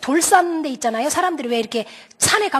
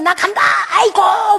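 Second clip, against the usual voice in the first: exasperated outburst; 5 semitones higher, 4 dB louder, slower than usual. Acted. Angry boy storms off.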